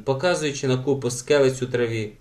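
ordinary delivery

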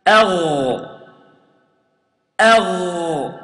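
أغ with a heavy throaty ghain; strong ghain. Shown as a demonstration. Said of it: "I pronounce the ghain like this".

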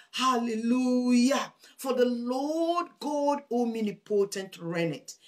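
exultant preacher's cry of worship; proclaimed praise. Shown as a demonstration. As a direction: ring out the praise slowly, stretching the long vowels. Models praise to shout in darkness.

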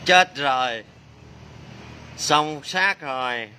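slow, drawn-out playful lament; mock despair. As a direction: stretch it out as if all is lost. Đùa than khi thao tác hỏng. (than vãn đùa, kéo dài giọng)

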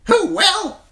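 very high, loud bird squawk of the words; a talking raven parroting its master; raven echoing. Acted. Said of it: "chimed the talkative raven".

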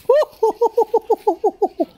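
very high, rapid string of hoots; high hooting laugh. Real, not acted. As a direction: hoot with glee, way up high. Gleeful reaction to the scene.

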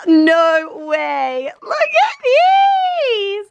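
very high, slow shriek of disbelief; excited shriek. Real, not acted. Vlogger finding treats in the gift bag, high excited shriek. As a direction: shriek with joy at the find.

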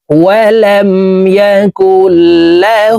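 "له" chanted with rounded lips and a held u; one-alif stretch. Shown as a demonstration. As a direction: draw out the hu of "له" in a slow chant. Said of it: "একালিপ টেনে উচ্চারণ করে পড়তে হবে".

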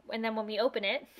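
ordinary talk

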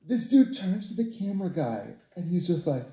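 ordinary delivery

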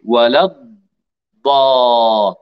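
ولا الضا- drawn out slowly, taking time on the ض; don't rush, hold the ض of الضا; slow daad. Shown as a demonstration. Teacher to Nisreen, showing a slow unhurried daad. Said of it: "لا تستعجلي في لفظ الضاد".

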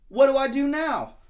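high, loud panicked wail; frantic. Acted. Shark desperately asking Lobster for help, frantic high wail. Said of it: "said Shark frantically".

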